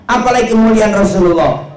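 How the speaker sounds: high, loud preacher's cry; fervent exclamation. Real, not acted. Preacher exalting the glory of the Prophet, fervently loud. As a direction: proclaim it to the whole crowd.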